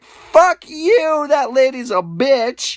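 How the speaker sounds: raging at the store lady; angry outburst. Retold or imitated. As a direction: spit it out after slamming the door. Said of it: "And I got mad".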